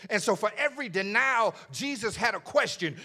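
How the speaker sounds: preacher building to the question; building preaching. Acted. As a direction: drive it up toward the question.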